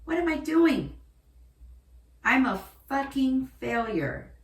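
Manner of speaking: acted-out cry of despair; retold tearful despair. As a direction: berate yourself as if at rock bottom. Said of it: "in my bed in tears going".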